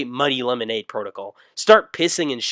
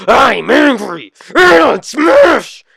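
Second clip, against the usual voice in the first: slow, far higher, far louder roar; Hulk yell; 13 semitones higher, 14 dB louder, much slower than usual. Acted. Son yells in his Hulk rage before smashing the door. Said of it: "start yelling".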